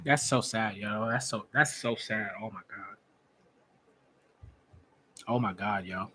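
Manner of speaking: low, subdued, saddened murmur; stunned sadness. Real, not acted. Fan reacting to a grim discovery, low and saddened. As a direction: let the voice sink in dismay.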